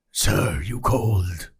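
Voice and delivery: low, heavy polar bear voice. Acted. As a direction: speak as a deep-voiced bear. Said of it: "a polar bear who is just walking, going".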